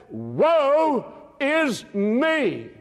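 very slow, high drawn-out woe; Isaiah's lament. Acted. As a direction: stretch each word as a broken prophet. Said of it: "then he said".

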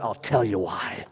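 ordinary talk

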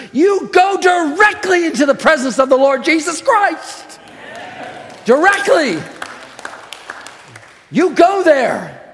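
ringing, fervent pulpit preaching; preaching at full force. Acted. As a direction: proclaim it to the whole church with fire. Preaching to the congregation.

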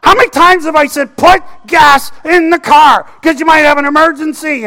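high, loud mock outburst at God; exasperated prayer. Driver praying over the empty tank, loud exasperation. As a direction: plead loudly, as if at your wit's end. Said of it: "I'm praying all the way".

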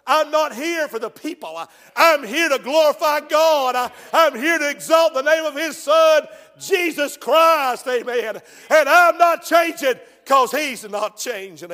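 loud, high-pitched pulpit proclamation; full-force preaching. Real, not acted. Preacher tells the congregation why he won't change, full-force preaching. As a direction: thunder it out phrase by phrase.

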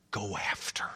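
hushed, voiceless close; whisper. Real, not acted. Preacher hushing the congregation on a key point. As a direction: drop to a whisper for emphasis.

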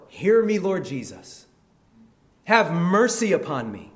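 loud plea, easing toward the end; crying out. Acted. Blind man begs Jesus to hear, crying out. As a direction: cry out high, then come down. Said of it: "Crying out all the more".

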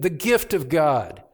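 ordinary talk

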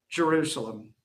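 "Jerusalem" dropped low and soft in grief; lamenting call. Acted. Jesus lamenting over the city, soft and falling. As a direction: sigh out "Jerusalem" like weeping. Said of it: "a lament of weeping".

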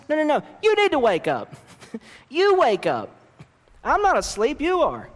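gently scold, almost laughing; a loving God turning the tables; God's playful rebuke. Pretend. God answers his people playfully.

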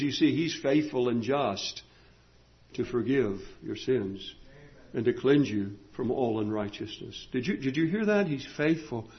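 low, soft pulpit reassurance; hushed, intimate sermon aside; quiet preaching. Real, not acted. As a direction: drop down and dwell on the key word. Preacher drops to quiet assurance.

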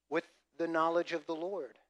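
ordinary delivery

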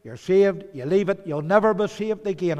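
fervent pulpit rise; building preaching. Real, not acted. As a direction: drive the warning home with rising force. Preacher rising to a climax.

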